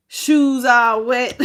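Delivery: slow, laughing words; laughing while talking. Real, not acted. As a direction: crack up as you describe it. Laughing at the image in the card.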